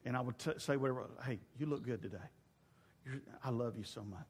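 a hint of a whisper to his wife; soft intimate murmur. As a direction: murmur it close into her ear. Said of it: "whisper her in her ear".

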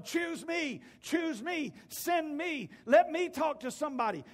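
loud, high, insistent plea; eager pleading. Acted. Acting out eager plea to God.

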